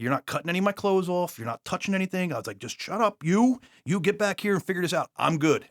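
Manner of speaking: high, pushy retold yelling; yelling at the nurse. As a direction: bark orders at the nurse. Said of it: "Stop yelling".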